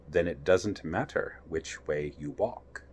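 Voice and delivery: a lazy grinning cat, unhurried; Cheshire Cat. Acted. Cheshire Cat voiced by reader.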